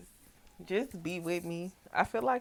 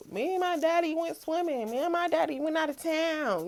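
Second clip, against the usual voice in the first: put-on little girl voice; 11 semitones higher than usual. Imitating child telling stories.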